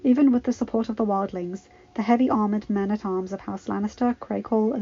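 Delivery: plain reading voice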